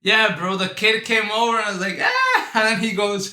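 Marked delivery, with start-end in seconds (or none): 2.0-2.5 high, loud "ah" shriek; mock scream. Teen reacting to the kid coming over, mock scream. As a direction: imitate a startled high scream.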